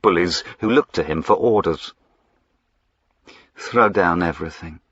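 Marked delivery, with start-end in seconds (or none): none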